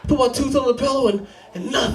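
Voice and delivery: put-on very high, let-down little-boy voice; sad kid. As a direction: imitate a crestfallen child complaining to Dad. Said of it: "all sad, like".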